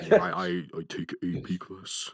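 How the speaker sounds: high dopey caveman voice. Retold or imitated. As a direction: say it like a clueless caveman. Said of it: "It sound like a caveman".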